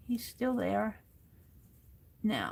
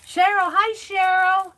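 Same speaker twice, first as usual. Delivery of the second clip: high, loud, bright hello to a friend; excited greeting; 9 semitones higher, 12 dB louder than usual. Real, not acted. Greeting a viewer who just joined the chat, excited and loud. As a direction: call out her name with delight.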